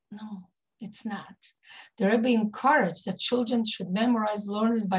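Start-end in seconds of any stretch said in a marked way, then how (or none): 0.1-1.4 slow, hushed, emphatic denial; firm whisper. Real, not acted. Correcting herself about the psukim, a slow firm whisper. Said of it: "slow whisper correcting".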